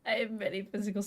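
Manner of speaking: normal talking voice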